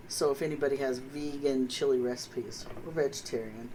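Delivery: ordinary talk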